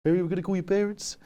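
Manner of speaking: very high, silly mock teacher voice; stupid teacher voice. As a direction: squeak it out like a ridiculous teacher imitation. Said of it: "had a voice like this".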